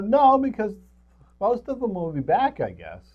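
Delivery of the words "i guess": normal talking voice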